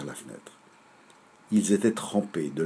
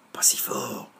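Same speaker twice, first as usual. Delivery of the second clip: whisper; 8 semitones lower, slower than usual. Acted. Child warns to keep quiet.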